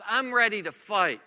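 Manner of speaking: loud, punchy challenge; defensive, combative. Acted. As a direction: square up for a fight. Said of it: "Defensiveness says".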